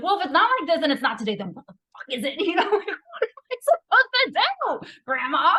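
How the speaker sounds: high, rushed mock fury breaking into laughter; retold exasperated outburst. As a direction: protest at grandma, pitch soaring, half laughing. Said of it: "finally I got so mad".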